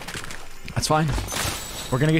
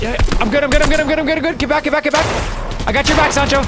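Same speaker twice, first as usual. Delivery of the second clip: high, fast, urgent gamer yelling; excited callouts; 8 semitones higher than usual. Real, not acted. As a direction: hype up a teammate mid-fight.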